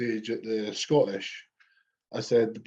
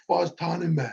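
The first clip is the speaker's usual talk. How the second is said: higher pitched demanding voice; a lightly voiced frantic mate begging for a tan; imitating Davy; 6 semitones higher than usual.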